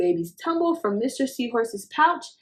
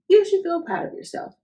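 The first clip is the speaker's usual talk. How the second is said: much higher, proud praise; encouraging; 10 semitones higher, 4 dB louder, faster than usual. A narrator's reading. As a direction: cheer him on. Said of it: "said Mister Seahorse".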